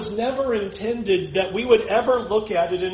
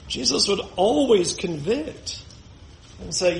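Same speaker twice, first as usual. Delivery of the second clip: ordinary talk, nothing marked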